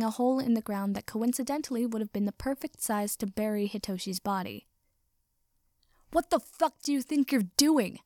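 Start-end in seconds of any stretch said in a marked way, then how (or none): 6.1-8.0 higher-pitched outraged yell; angry howl. A narrator's reading. Katsuki yells down from his perch, outraged howl. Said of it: "howled Katz from his cat-like perch".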